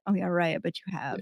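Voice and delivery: quick, low sarcastic aside; retold skeptical thought, tossed off softly; dismissive eye-roll. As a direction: mutter it fast, unconvinced. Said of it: "rolling my eyes thinking like".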